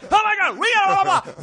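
high, loud panicked doctors; frantic doctors. Retold or imitated. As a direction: rush in shouting high. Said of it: "they're like".

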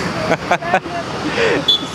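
real laugh after a prank. Real, not acted. Prankster amused by the fan's reply, laughing. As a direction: burst out laughing.

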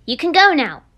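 ordinary talk, nothing marked

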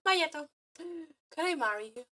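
ordinary talk, nothing marked

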